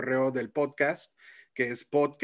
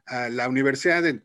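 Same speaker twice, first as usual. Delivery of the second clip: ordinary talk, nothing marked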